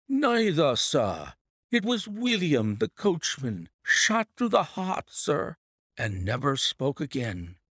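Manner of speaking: high, agitated servant reporting; distressed butler. Acted. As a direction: report the shooting in alarm.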